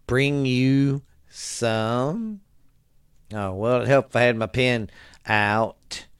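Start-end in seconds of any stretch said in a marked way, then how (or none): none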